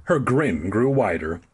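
ordinary delivery

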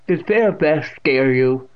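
put-on loud, drawn-out deaf old woman; smiling old aunt. A narrator's reading. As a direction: ask loudly with a smile. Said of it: "still smiling".

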